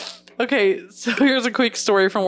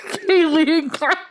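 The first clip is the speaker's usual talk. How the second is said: laughing mimic; 6 semitones higher than usual. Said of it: "I don't know those Jenner girls".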